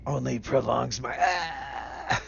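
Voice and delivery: villain's line cut off by a cry; dying villain. Acted. Game master voices the poisoned foe as she dies, crying out. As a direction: boast that breaks into a death cry. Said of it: "She's like".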